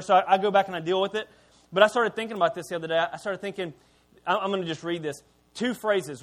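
ordinary delivery

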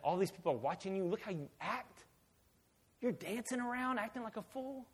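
softly scolding, climbing in pitch; quiet, rising reproach; disapproving wife. Retold or imitated. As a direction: imitate a wife's hushed, incredulous rebuke. Wife scolds David, acted.

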